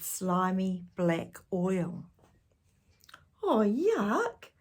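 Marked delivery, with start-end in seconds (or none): 3.4-4.5 disgust. A narrator's reading. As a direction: recoil from something slimy. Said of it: "said Tom".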